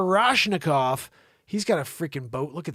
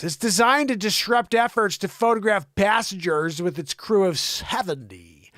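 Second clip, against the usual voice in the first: ordinary delivery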